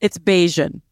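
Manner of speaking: Bajan with zh and stress on BAY; Bajan pronunciation. Shown as a demonstration. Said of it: "can you say it again".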